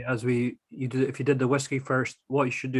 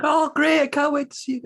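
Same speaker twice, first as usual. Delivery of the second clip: much higher and louder, squealing mock girl voice; gushing American girl; 6 dB louder than usual. Girl hears they are off to hunt the haggis, squealing mock excitement. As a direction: gush like an overexcited girl. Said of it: "And she was like".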